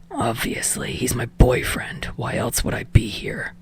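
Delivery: sullen breathy hush, impatient. A narrator's reading. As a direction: mutter it low and grudging, almost voiceless. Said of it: "His words are sullen, impatient".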